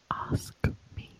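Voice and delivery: hushed, insistent whisper; menacing whisper. Acted. Insisting after being refused twice, in a whisper. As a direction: whisper the demand very softly. Repeats demand after second no.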